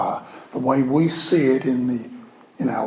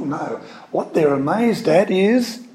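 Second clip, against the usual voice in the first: ordinary delivery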